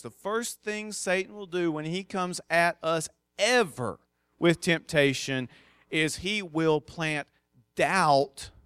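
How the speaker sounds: usual speaking voice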